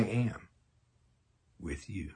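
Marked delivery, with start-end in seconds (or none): 1.6-2.1 dropping to a soft whisper on the last words. Acted. Preacher whispering the Lord's promise to his listeners, whispered. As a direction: breathe the words out with no voice.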